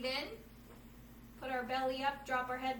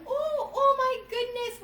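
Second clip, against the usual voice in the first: much higher, louder and slow oh my goodness; mock surprise; 11 semitones higher, 10 dB louder, slower than usual. Teacher pretending the truck goes off the road, hammed-up gasp. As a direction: act out swerving off the road.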